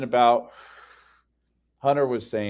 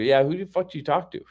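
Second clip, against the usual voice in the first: retold exasperated demand, pitched up; irritated, higher-pitched retort; frustrated challenge; 5 semitones higher than usual. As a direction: challenge them, fed up. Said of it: "I'm, like, frustrated".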